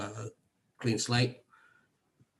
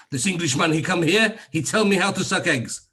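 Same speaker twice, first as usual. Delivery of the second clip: higher, louder mock Cypriot broken English, cheeky; 6 semitones higher, 5 dB louder than usual. Mimicking his Cypriot dermatologist's scorn, loud mock broken English. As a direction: poke fun at the Cypriot doctor's broken English, high and loud. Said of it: "mocking the Cypriot doctor's broken English".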